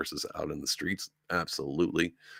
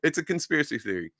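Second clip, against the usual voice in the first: mock dismissive media; 8 semitones higher, faster than usual. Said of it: "to be like".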